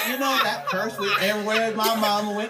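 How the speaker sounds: ordinary talk, nothing marked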